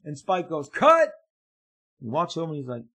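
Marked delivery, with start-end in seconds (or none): 0.7-1.1 shouted cut. Retold or imitated. Director calls cut.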